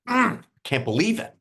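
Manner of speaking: high mock groan of disbelief; squeaky hammed-up can't believe it. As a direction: play the frustrated player, pitched high. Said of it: "I'm like".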